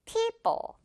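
people starting high, then dropping; stress on first syllable. Shown as a demonstration. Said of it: "앞부분에 강세 줄게요".

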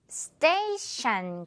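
스테이션 with the last syllable said as shan; slow model word, 스테이션 ending in shan not tion. Shown as a demonstration. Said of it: "티아이오엔 부분 샨 처리해주면서".

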